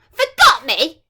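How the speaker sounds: the mean fairy, furious at being forgotten; screaming. Acted. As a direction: scream it back in fury. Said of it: "Nightshade screamed".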